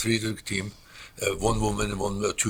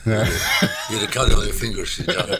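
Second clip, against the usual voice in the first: laughing; 3 semitones higher, 7 dB louder than usual. Real, not acted. Teasing host about bad math.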